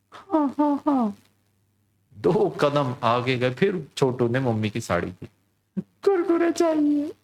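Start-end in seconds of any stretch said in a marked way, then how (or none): 0.1-1.2 put-on high eager little boy; high child voice promising mom. Chhotu agrees to behave. 5.8-7.1 put-on high, slow whiny little boy; little boy nagging mom again; child voice. Chhotu pulls sari again, demands.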